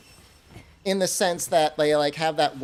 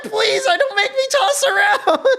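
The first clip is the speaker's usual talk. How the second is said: very high, louder crying-laughing beg; laughing plea; 14 semitones higher, 7 dB louder than usual. Retold or imitated. Asked what kind of sleeper he is, high laughing plea. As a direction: plead through laughter and panic. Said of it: "And I'm like".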